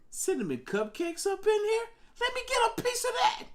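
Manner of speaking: very high, excited falsetto; comic high-pitched begging; playful squeal. Pretend. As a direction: squeal it in mock craving. Playful riff on a viewer's username.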